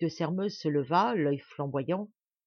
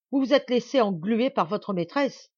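loud, high-pitched insult; exasperated accusation; 5 semitones higher, 7 dB louder than usual. A narrator's reading. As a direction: hurl the charge angrily. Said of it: "ce qui l'exaspérait plus que tout". (accusation irritée, lancée fort)

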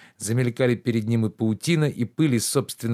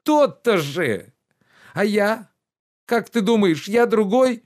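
higher, louder reproachful gentleman; lordly rebuke, pitched up and loud; indignant master Oblomov; 11 semitones higher, 6 dB louder than usual. Acted. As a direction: scold the servant with wounded pride.

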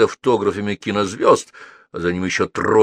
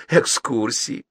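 contemptuous; slower than usual. Acted. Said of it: "презрительно фыркнула Долис".

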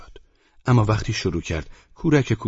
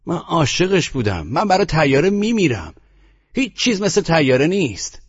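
much higher, louder mock gushing; sarcastic rapture; 12 semitones higher, 6 dB louder than usual. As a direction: overdo the love for the factory to mock her. Mock rapture after mimicking Jinny.

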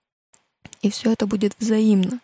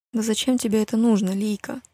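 usual speaking voice

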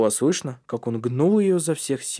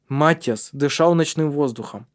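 usual speaking voice

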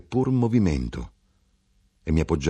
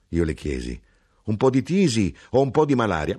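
plain reading voice

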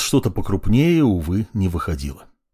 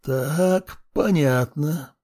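hissing; 5 semitones higher, much slower than usual. A narrator's reading. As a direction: hiss it out slowly. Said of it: "прошипел некромант".

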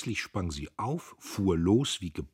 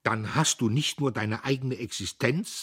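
high, louder, building; indignant accusation; 7 semitones higher, 5 dB louder than usual. A narrator's reading. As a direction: accuse her righteously. Diederich accuses his sister.

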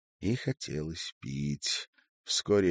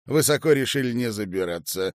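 ordinary delivery, nothing marked

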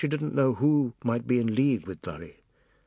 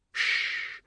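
voiceless sharp shush; hushing. Acted. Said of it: "Bipti said".

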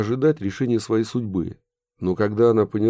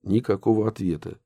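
ordinary delivery, nothing marked

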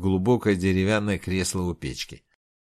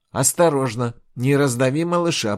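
loud, sharp warning; warning call; 5 semitones higher, 5 dB louder than usual. Acted. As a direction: warn her off the scorpion. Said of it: "предупредила Инг". (громкое предупреждение)

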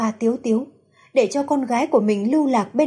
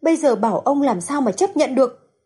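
ordinary delivery

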